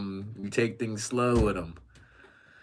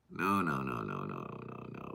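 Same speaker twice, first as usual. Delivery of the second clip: low, soft run of no's fading away; dismayed refusal; 10 semitones lower, 9 dB quieter than usual. Real, not acted. Streamer rejects a viewer's message, quietly dismayed. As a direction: sink lower and quieter with each no.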